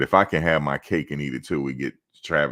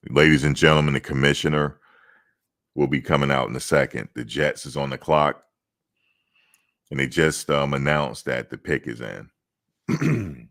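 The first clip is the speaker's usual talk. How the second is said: louder formal stage announcement; draft announcer; 3 dB louder than usual. Pretend. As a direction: address the crowd like a league announcer.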